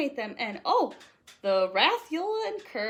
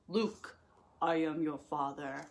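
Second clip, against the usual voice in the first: lightly voiced lower Vader; a hint of Darth Vader's reveal, lower; Vader line; 8 semitones lower than usual. Vader reveals the truth to Luke, lightly lowered menace. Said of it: "compared with".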